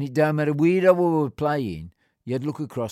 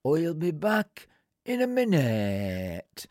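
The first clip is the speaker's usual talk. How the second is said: lightly voiced old man, slow and unhurried; slow, easy old-bloke reply; slow old groundsman; 2 semitones higher, much slower than usual. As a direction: drag it out like an old groundsman who won't budge.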